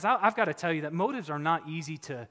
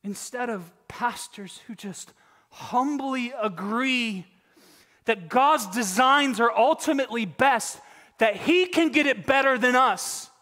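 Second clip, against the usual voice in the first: louder, higher fiery preaching; 7 semitones higher, 7 dB louder, slower than usual. Acted. Preacher rebukes false pastors to the flock, preaching hard. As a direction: drive it home from the pulpit.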